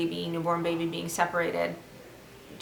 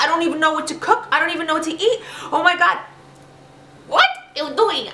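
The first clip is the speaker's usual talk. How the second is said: higher and louder, frantic self-questioning; panicked inner voice; 6 semitones higher, 4 dB louder than usual. Retold or imitated. New vegan panicking about family and food, mock frantic. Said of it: "Then you start thinking".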